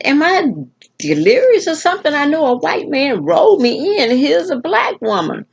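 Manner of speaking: retold astonishment, pitched very high; high, incredulous questioning of your own senses; bewildered disbelief. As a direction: re-enact baffled shock, voice way up. Retells her bewilderment at the switch.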